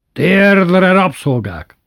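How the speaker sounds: high, loud held yell that drops; long tyrannical shout; shouted command. A narrator's reading. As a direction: bellow the order at defiant servants. Brother repeats order more fiercely.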